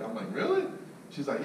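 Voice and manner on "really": leaping high question; can't believe it, thrilled; excited disbelief. Retold or imitated. Salesman hearing who her son is, thrilled. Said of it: "I really am excited".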